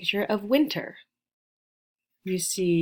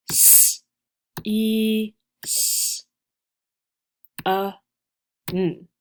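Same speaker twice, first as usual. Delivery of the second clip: letter sounds one at a time with pauses; "S, E, S, A, N" spaced out sound by sound; slower than usual. Shown as a demonstration. Teacher counts sounds with students, spaced out. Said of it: "Count the sounds with me".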